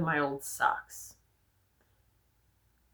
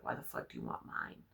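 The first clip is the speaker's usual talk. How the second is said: retold whisper; 14 dB quieter than usual. Retold confronting the bully.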